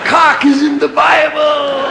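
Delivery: shouted high and slow like a revelation; booming mock sermon shout; loud proclamation. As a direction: proclaim it to the crowd. Shouted punchline to audience.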